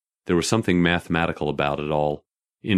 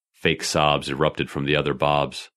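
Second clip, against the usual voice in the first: ordinary delivery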